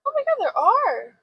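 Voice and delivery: high, drawn-out amazed reaction; real surprise. Real, not acted. Friend confirming there are fireworks, high amazed surprise. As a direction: stretch it out in high-pitched wonder.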